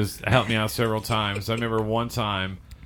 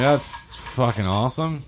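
fake enthusiasm; 3 semitones higher than usual. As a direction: sell it as genuinely thrilled. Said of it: "was like".

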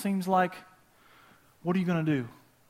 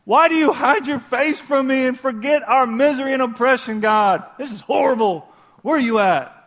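lament; 7 semitones higher, 7 dB louder than usual. Acted. Psalm lament acted out.

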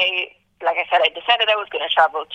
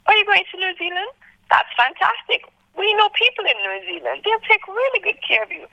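high-pitched imitation of thrilled relatives; delighted. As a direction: gush supportively, pitched way up. Family reacts delightedly to plan.

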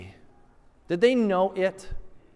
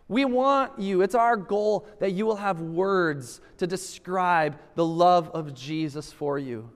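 ordinary talk, nothing marked

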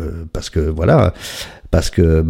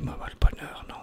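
whispered muttering to himself; hushed aside; 14 dB quieter, faster than usual. Acted. Hiding inside as happiness knocks, whispered refusal. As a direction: whisper it so nobody outside hears.

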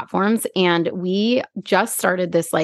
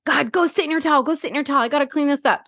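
put-on higher, urgent orders to the kids; frantic mom; 7 semitones higher, faster than usual. Mother ordering her kids out of the bathtub, frantic and high. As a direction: hurry them out while fighting nausea.

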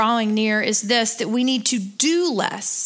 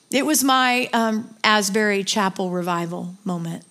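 usual speaking voice

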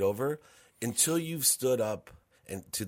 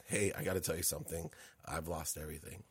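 hushed, near-tearful confession to mom; barely above a breath, holding back tears; quiet choked-up admission; teary-eyed; 5 semitones lower, 11 dB quieter than usual. Retold or imitated. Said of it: "I was teary-eyed".

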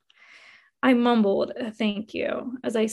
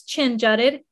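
ordinary delivery

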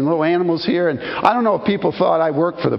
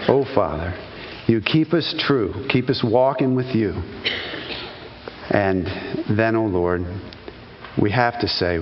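prayer; 7 semitones lower than usual. Acted. Closing prayer.

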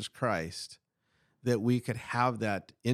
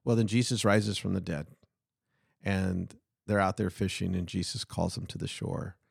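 ordinary delivery

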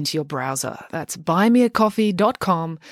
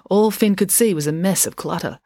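usual speaking voice

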